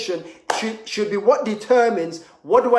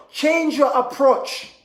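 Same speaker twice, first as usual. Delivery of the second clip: ordinary delivery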